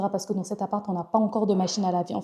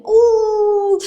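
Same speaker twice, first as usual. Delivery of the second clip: écho; 13 semitones higher, 9 dB louder than usual. Real, not acted. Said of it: "vous allez entendre l'écho".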